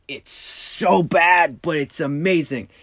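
gushing. Real, not acted. Gushing about bad movie.

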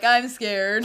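loud, drawn-out cowardly lion; mock-terrified stage lion; scared lion. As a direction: wail it like a hammed-up frightened lion. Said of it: "the lion's probably like".

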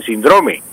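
síndrome as sin-DRO-me; Spain's stress pattern. Shown as a demonstration. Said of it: "en España dicen".

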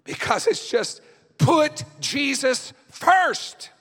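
loud, high, fervent preaching at full force; frustrated preaching. Real, not acted. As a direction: pour your frustration into the sermon, loud and high. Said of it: "I sound frustrated in my voice".

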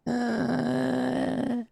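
one long breathed-out, wavering word; slow, eerie gasping creature; raspy, windy shadow creature. Acted. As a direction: let the word trail off like wind. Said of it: "you hear that long, raspy, windy voice".

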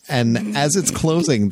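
usual speaking voice

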